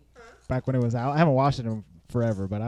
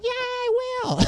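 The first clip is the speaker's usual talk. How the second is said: high, drawn-out pretend cheer; hammed-up little hooray; mock crowd cheer. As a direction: cheer like an imitated school crowd. Voicing the whole school cheering.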